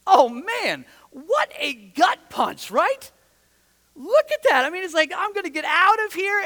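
loud, high cry of disbelief; dismayed outcry. Real, not acted. Preacher reacting to the betrayal, loud and pained. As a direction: hit it like a gut punch.

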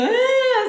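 high, loud exaggerated bawl; mock crying wail. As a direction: wail like crying through therapy, hammed up. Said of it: "llore y llore".

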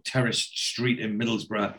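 ordinary talk, nothing marked

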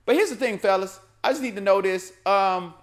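ordinary talk, nothing marked

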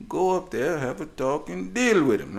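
high, loud, exasperated mock Italian mom. Daughter orders dad up there, exasperated Italian mom impression. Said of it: "it almost felt like an Italian mom".

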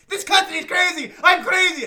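high, loud shouting; mock screaming. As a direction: scream while slapping him. Madeline slapping Tim.

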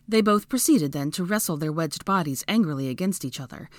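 plain reading voice

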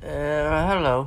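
slow, hesitant greeting; a touch of nerves, drawn out; nervous. A narrator's reading. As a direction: say it slowly, unsure. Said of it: "said Harry nervously".

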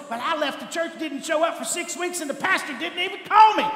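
mock complaint. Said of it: "We think".

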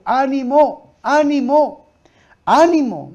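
high, loud retold call to take heart; rousing encouragement. Jesus to frightened disciples, rousing encouragement.

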